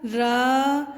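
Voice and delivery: ordinary talk, nothing marked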